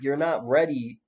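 ordinary talk, nothing marked

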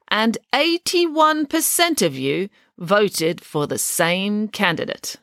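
stately president reading out results; formal speech. A narrator's reading. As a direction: announce results to a crowd, drawing out the words.

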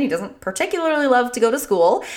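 ordinary talk

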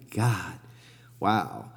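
hushed, low-pitched "wow" under the breath; softly taken aback, almost to himself; quiet awe. Retold or imitated. Inner reaction to friend's mansion.

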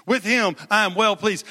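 ordinary talk

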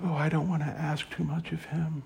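high, hushed little voice; softly mocking timid prayer; timid, quiet praying. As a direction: mimic a meek soul afraid to ask. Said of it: "how timid and quiet and quiescent".

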